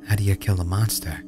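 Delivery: ordinary delivery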